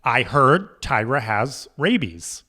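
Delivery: usual speaking voice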